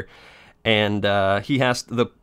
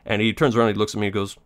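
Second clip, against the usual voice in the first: ordinary talk, nothing marked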